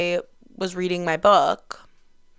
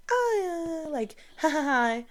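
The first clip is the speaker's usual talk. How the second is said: awkward laugh; 8 semitones higher, 4 dB quieter than usual. Retold or imitated. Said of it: "shakes it off".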